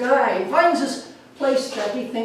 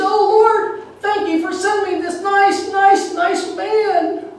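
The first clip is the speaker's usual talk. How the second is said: amazed thanks; 10 semitones higher, 4 dB louder, slower than usual. Retold or imitated. Amazed woman thanks the Lord.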